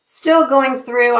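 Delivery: usual speaking voice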